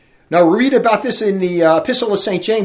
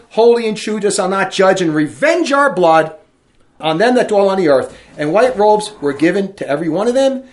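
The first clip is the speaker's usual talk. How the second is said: loud, solemn preaching read; scripture reading; 5 dB louder than usual. Acted. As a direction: proclaim the verse to the congregation. Preacher reads Apocalypse passage.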